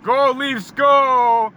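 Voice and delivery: high, loud jeering chant; loud high taunting cheer; mock cheer. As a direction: yell it in their faces like a rowdy away fan. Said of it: "and I was like".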